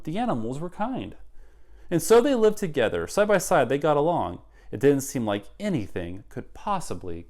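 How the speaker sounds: put-on lively rhyming read-aloud for kids; story narration. A narrator's reading. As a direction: read it like a picture book to children. Reads picture book aloud.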